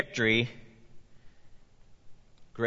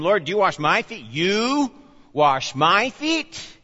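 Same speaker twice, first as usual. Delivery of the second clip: incredulous Peter; 7 semitones higher, 5 dB louder, slower than usual. Acted. Peter balks at Jesus washing.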